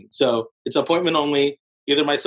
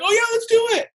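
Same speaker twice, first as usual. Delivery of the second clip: much higher, bright mock-eager yes; eager client. Physician client eagerly agreeing to buy, much higher excited voice. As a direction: play the keen doctor jumping in.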